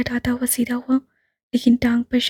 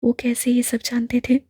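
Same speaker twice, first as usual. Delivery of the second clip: ordinary delivery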